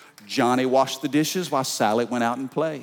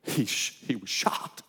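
softer, lower hush; 7 semitones lower, 8 dB quieter than usual. Acted. Preacher telling the duck's death, stunned hush.

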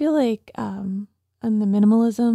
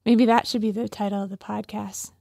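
normal talking voice

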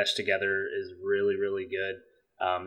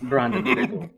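laughing; 13 semitones higher than usual. Real, not acted. Repeating funny name, laughing.

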